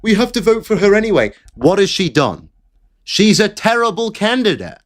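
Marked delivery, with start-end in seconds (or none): 0.0-1.3 high, fast mock gushing fan; mocking voter. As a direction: ham up a breathless supporter. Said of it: "and saying".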